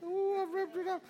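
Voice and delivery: comic high-pitched whine; mock wail. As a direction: pretend to wail it in a high, thin voice. Feigned wail at overrunning sermon.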